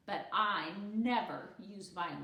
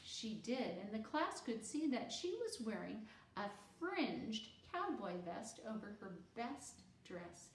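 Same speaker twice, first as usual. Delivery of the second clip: ordinary delivery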